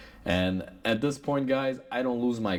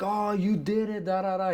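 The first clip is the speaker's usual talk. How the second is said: mock scream; 10 semitones higher than usual. Mechanic imitating the customer freaking out, mock scream. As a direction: ham up his freak-out at a high pitch. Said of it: "He's just screaming".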